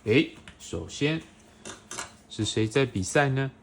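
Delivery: put-on bright, animated read-aloud for a small child; reading to a toddler. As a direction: read it like a bedtime picture book. Parent reading picture book to child.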